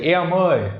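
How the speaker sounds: "air moi" with the first vowel a gliding diphthong, countryside Vietnamese accent; countryside Nha Quê accent. Shown as a demonstration. Teacher shows what they say, countryside Vietnamese accent. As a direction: rural Nha Quê call, "air" drawn into two sounds. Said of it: "countryside Nha Quê accent".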